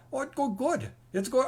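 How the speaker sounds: light imitation of an Indian accent, pitched high. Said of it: "I should try to mimic his accent".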